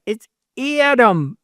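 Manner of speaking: high, stretched nasal Michigan Adam; Lake Erie accent on Adam. Acted. Showing how Cleveland people say Adam, nasal Lake Erie accent. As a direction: send Adam out through the nose, Lake Erie style. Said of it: "goes right out through your nose".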